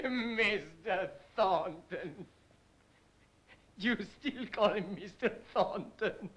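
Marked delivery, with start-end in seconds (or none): 0.0-2.3 repeats the name through laughter; laughing. Acted. Mocking his girl, laughing at her boss's name. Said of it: "stop laughing at me".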